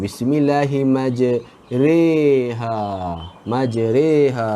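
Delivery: Bismillahimajreha slow, "re" in majreha; slow re reading. Shown as a demonstration. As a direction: stretch majreha with "re" slowly. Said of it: "kita kena baca re".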